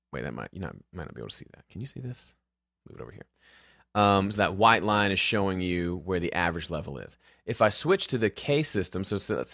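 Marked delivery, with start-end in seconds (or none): 0.1-3.3 much softer and lower, half to himself; a quiet aside while fiddling with the screen; distracted self-talk. Real, not acted. Host checking whether viewers can see this, distracted self-talk.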